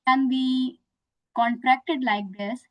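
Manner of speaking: normal talking voice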